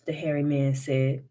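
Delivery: plain reading voice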